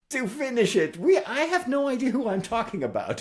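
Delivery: high-pitched feigned innocence, playful; mock innocent. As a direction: play dumb about who you mean. Teasing a slow unnamed author.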